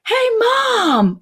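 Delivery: put-on high child voice calling mom; toddler calling out. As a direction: pipe up like an excited two-year-old.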